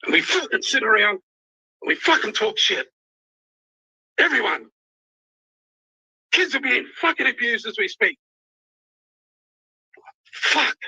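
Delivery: high-pitched, emotional outburst with long pauses; real emotional upset. Real, not acted. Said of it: "I'm fucking emotional".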